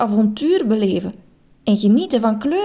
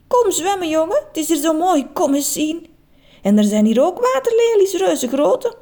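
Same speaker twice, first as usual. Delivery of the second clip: put-on high, eager old lady; inviting granny; 8 semitones higher than usual. A narrator's reading. Grandma inviting her grandson to swim, high and eager. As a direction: lure him in.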